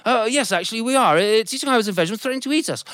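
high, hurried flustered voice; a harried father explaining his trouble; high fast worried reply. Acted. Father answers the soldier.

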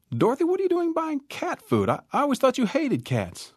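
very high surprised woman voice; surprised neighbor. Acted. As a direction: sound amazed at her buying cat food.

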